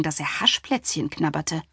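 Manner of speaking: usual speaking voice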